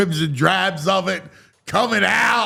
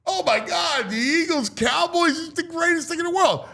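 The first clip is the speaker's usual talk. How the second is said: gushing fan; 6 semitones higher than usual. Retold or imitated. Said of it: "it's like".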